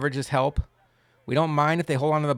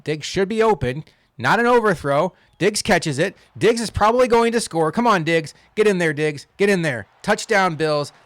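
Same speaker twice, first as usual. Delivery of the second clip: raised, louder touchdown call; excited play call; 5 semitones higher, 5 dB louder than usual. Real, not acted. Gamer cheering Diggs toward the end zone, excited. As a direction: urge the receiver on, voice climbing with real excitement.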